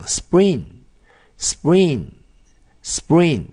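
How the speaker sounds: spring, spring, spring said very slowly and clearly; slow model English. Shown as a demonstration. Teacher modeling spring slowly for learners. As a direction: draw out spring for the class. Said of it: "英文怎么讲呢？".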